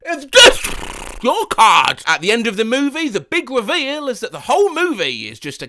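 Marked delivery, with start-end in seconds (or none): 0.1-2.0 loud, slow, lisping cartoon duck yell; Daffy Duck voice. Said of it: "in a Daffy Duck voice yell".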